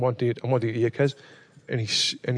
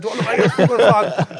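high, louder indignant challenge; retold outrage; 7 semitones higher, 5 dB louder than usual. Telling mates how he challenged the driver, loud high-pitched indignation. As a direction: re-enact the offended comeback with a laugh in it. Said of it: "just proper angry".

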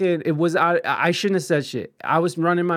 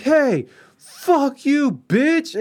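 high, loud, slow jeering yell; drunken taunt; 10 semitones higher, 4 dB louder, much slower than usual. Retold or imitated. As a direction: drag it out to taunt him as he walks off. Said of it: "I would just be like".

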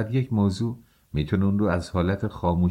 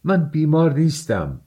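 much higher, louder denial; shouting; 8 semitones higher, 4 dB louder than usual. A narrator's reading. Raskolnikov shouting back at Luzhin, high loud denial. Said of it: "راسکولنیکوف فریاد زد".

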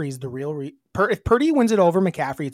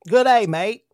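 loud, higher mock Australian; badly aimed English accent landing as Australian; hammed-up Australian greeting; attempted Australian accent; 4 semitones higher, 5 dB louder, slower than usual. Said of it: "That's Australian".

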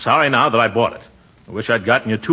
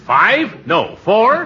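self-correction; 9 semitones higher, 4 dB louder, much slower than usual. Acted. Uncle correcting count mid-list.

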